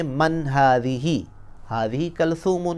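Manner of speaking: मन हादी ही said slowly and clearly; slow Arabic model phrase. Shown as a demonstration. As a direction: model हादी ही कलसूमुन for students. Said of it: "लेकिन यहाँ क्या कहेंगे".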